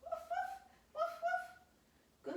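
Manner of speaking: very high little woofs; dog bark. Pretend. Teacher in dog pose with children, high dog bark. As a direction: yap in pairs like a small pup. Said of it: "like you're wagging your tail".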